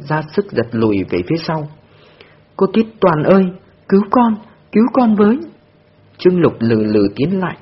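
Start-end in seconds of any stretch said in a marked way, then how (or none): 2.6-5.4 put-on child's scream, higher and louder, begging to be saved; crying for help. A narrator's reading. Terrified girl screams for Tuyết Toàn to save her. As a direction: shriek for help as she's dragged to the car. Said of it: "khóc toáng lên".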